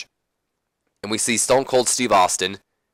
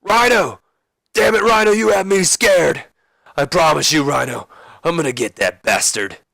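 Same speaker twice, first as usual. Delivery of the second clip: hammed-up high, loud outburst; Stone Cold Steve Austin; 7 semitones higher, 4 dB louder than usual. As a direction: cry out in relief, settle into a vow. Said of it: "Austin just goes".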